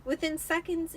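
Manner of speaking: normal talking voice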